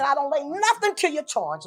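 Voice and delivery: high, loud reenacted cry of grief; retold grief cry. Pastor recalls the mother's cry at church, crying out. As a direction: cry it out high, as if pleading to God. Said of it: "what you was crying out".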